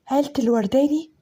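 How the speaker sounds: usual speaking voice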